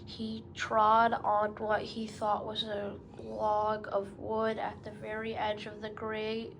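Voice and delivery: usual speaking voice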